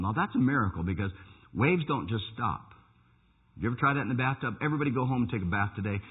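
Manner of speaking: ordinary delivery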